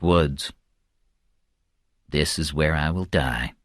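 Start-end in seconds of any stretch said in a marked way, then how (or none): 2.1-3.5 low, hushed, resigned; quiet voice of private thought; resigned inner thought. A narrator's reading. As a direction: think it to yourself, softly and low. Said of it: "he thought".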